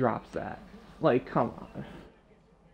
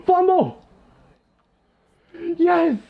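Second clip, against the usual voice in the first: loud, much higher cheer of joy; real gamer celebration; excited shout; 13 semitones higher, 6 dB louder, much slower than usual. Real, not acted. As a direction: yell it like the game just turned. Defense forces a fumble.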